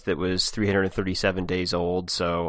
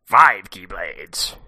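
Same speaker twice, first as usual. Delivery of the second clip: pirate voice; much slower than usual. Pretend. Joins the pirate bit.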